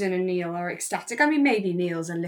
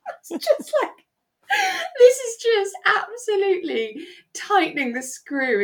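high, loud laughing speech; can barely talk for laughing; laughing hard; 13 semitones higher, 7 dB louder than usual. Real, not acted. Speaker cracks up over Jennifer's snobbery, laughing high.

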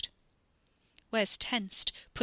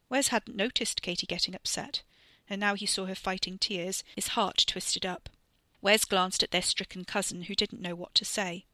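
usual speaking voice

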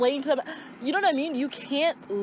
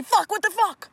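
high, loud startled curse; startled yell; 10 semitones higher, 5 dB louder than usual. Retold or imitated. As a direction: yelp at a sudden wasp. Yelling at a wasp.

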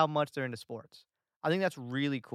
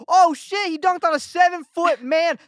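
loud, high "Oh, shit" at a dunk; courtside, excited high shout; 12 semitones higher, 11 dB louder than usual. Retold or imitated. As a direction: yell in delight at the dunk. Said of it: "And I'm like".

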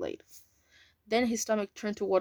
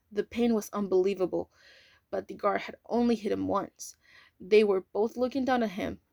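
plain reading voice

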